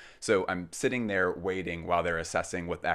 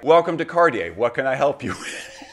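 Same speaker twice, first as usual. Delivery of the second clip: bright shop greeting; 4 semitones higher, 6 dB louder than usual. Retold or imitated. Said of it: "This is another woman who is like".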